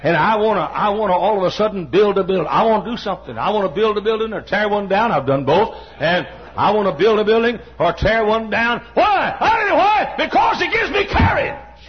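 fired-up pulpit delivery breaking into a shout; rousing preaching. Real, not acted. Preacher telling how another's sermons stir him, building to shouting. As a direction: build to a high, shouted climax.

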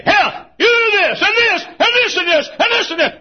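high, full-force shouted orders; big tyrant. Acted. Preacher acting God as a tyrant, shouting. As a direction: bellow each order like a tyrant. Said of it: "like a big tyrant and say".

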